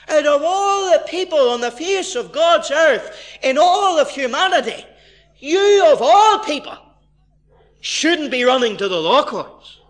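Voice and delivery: fervent indignant preaching; preaching climax. Acted. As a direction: thunder it from the pulpit.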